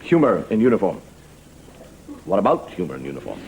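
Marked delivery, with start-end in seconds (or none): 2.2-3.4 much lower, falling delivery; low voice with a falling end; low-pitched repeat. Shown as a demonstration. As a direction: drop the whole question down low. Same phrase repeated differently.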